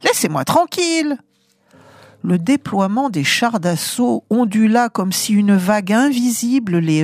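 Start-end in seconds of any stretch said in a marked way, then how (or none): 0.0-1.2 exasperated cry. Acted. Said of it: "cria-t-elle".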